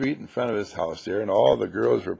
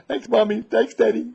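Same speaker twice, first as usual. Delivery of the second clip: higher, louder, cutesy thanks; a little child's chirpy thanks to mom and dad; high, playful kid voice; mock under-the-breath thanks; 9 semitones higher, 5 dB louder than usual. Shown as a demonstration. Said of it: "And under your breath".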